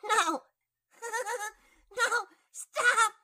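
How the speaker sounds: shrieking through laughter; squealing no, laughing helplessly; being tickled. Acted. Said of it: "Fauna reached over and tried tickling him".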